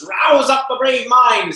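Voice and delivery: rally his courage at full voice; rousing shout. Acted. Fiend goading Lancelot to flee, slow rallying shout.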